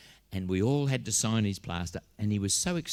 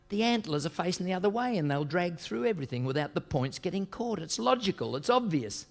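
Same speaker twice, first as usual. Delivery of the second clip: higher-pitched meddling adviser; know-it-all old hand poacher; 7 semitones higher than usual. Acted. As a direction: explain it insistently in a higher voice. Acts the meddling experienced poacher advising.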